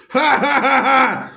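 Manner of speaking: hammed-up cartoon laugh; acted laugh. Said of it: "Roger the Dinosaur laughs".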